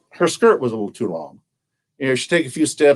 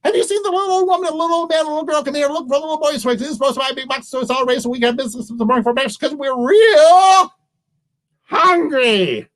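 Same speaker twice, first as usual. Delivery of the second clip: high, racing, breathless-paced squirrel voice; frantic high-pitched squirrel, building to the last word; squirrel talk; 10 semitones higher, faster than usual. Acted. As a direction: gabble the question in a high squeak. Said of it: "which the squirrel talk means".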